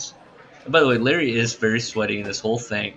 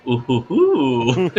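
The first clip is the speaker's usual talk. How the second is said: slow, loud mock "ooh-hoo-hoo"; exaggerated drawn-out hooting; [name]'s hooting; 3 semitones higher, 6 dB louder, much slower than usual. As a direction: hoot suggestively, drawn out. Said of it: "that gets a little from [name]".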